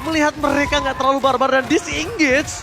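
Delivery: fast excited play-by-play; esports caster. Real, not acted. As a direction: call the fight live. Live casting, lively play-by-play.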